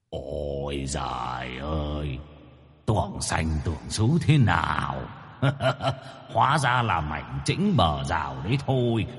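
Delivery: mocking ghost. Tied ghost mocks its captors.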